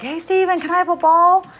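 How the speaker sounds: put-on high, loud kid calling out; child's voice. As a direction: call out like an eager little boy. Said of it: "my son's like".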